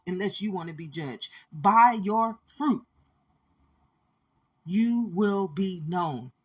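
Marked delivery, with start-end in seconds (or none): 1.5-6.3 slow emphatic scripture. Shown as a demonstration. Preacher warning the judging listeners, slow and weighty. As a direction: lean on every word like scripture. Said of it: "preacher quotes the verse".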